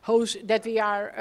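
ordinary talk

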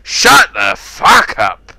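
slow, loud angry command; furious shout. Real, not acted. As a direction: hammer out every word. Yelling at player.

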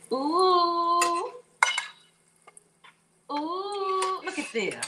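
high, loud ooh of delight. Real, not acted. Cook showing the burger to viewers, delighted ooh. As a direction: gasp with pleasure at the burger.

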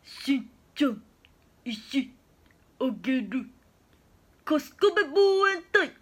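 slow, halting cartoon-child impression; dopey character voice, word by word; Bo-chan. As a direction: drop each word in separately, then call out the last phrase loudly. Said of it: "坊ちゃんです。".

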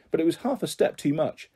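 ordinary delivery

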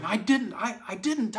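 put-on a child's high, pleading sob; sobbing child. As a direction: cry out the denial like a hurt little girl.

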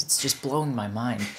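normal talking voice

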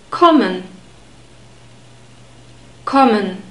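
Kommen with a short open O, as in morning; clipped German O in Kommen, like morning but short. Shown as a demonstration. Teacher models the word for learners, short open O in Kommen. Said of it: "in German it's always a short sound".

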